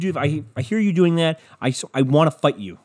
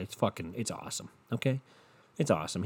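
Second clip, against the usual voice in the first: quiet aside; 6 semitones lower, 10 dB quieter than usual. Real, not acted. Soft aside about dog.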